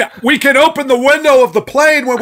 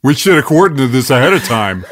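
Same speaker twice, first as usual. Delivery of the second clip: low put-on voice; 13 semitones lower, faster than usual. Guest at potluck, low put-on voice.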